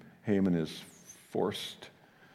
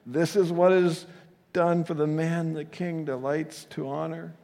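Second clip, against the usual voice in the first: high, strained herald forced to praise; horrified squeaky voice; 8 semitones higher than usual. Acted. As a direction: squeak it out in horror. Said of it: "with, I think, a horrified, squeaky voice".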